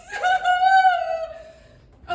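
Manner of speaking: high hammed-up howl; loud wailing laugh. Dragonfly wail turns to laugh.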